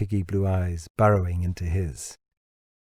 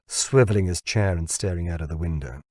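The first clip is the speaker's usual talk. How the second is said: usual speaking voice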